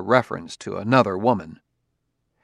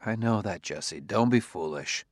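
soft reassurance; 2 semitones lower, 7 dB quieter than usual. A narrator's reading. Husband calming wife.

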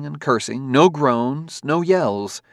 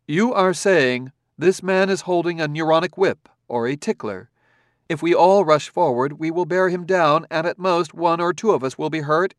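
calm, firm robot authority, a touch higher; confident command; 7 semitones higher, 5 dB louder than usual. A narrator's reading. Robot reasoning calmly with the angry crowd. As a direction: lay it out coolly, no anger. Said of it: "the tone of confident command".

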